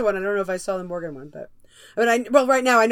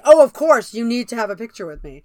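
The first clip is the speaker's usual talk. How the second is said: gushing Shangela; 2 semitones higher, 9 dB louder than usual. Retold or imitated. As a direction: throw it out like an eager diva. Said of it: "and Shangela was like".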